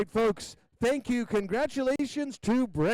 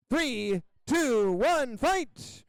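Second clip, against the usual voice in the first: fight countdown shout; 5 semitones higher, much slower than usual. Real, not acted. Announcer to the arena crowd, starting the fight, rising shout. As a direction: count down, then shout fight much higher.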